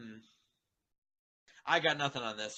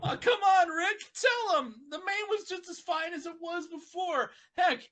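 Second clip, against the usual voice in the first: much higher, louder complaint; whiny rant; 8 semitones higher, 5 dB louder than usual. Acted. Spike complaining to Rick, higher and whiny. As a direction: protest indignantly.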